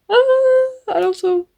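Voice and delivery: high, loud overwhelmed ah; emotional cry. Real, not acted. Reader overwhelmed by a bittersweet scene, a loud cry. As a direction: burst out, overwhelmed. Close to tears over the book.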